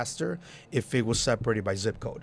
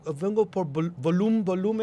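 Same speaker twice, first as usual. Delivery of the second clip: put-on higher-pitched Spanish accent, stumbling on "volume"; Spanish-accented mispronunciation; 7 semitones higher than usual. Mexican club-goers asking for the Volume flyer, Spanish accent. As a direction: imitate a Spanish speaker struggling with "volume", amused. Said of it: "they couldn't say volume".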